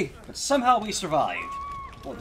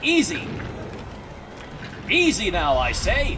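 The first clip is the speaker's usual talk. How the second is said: calling out; 3 semitones higher, 3 dB louder than usual. Real, not acted. Calming someone down.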